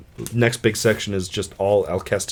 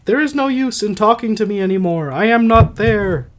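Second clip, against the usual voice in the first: high put-on wife's voice, slowing at the end; Alcestis voice; 10 semitones higher, 3 dB louder than usual. Dying wife dismissing her husband's pleas, high put-on voice. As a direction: pitch it way up, draw out the last words. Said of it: "I'll try to do voices".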